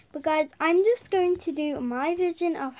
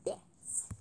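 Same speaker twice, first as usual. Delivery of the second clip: whisper; 16 dB quieter than usual. Real, not acted. Whispering while making icon.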